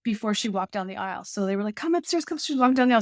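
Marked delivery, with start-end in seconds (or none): 1.8-3.0 higher-pitched hurry-along call; mock urging. Helpers urging the bride to the aisle, high mock urging. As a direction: imitate someone hustling the bride along.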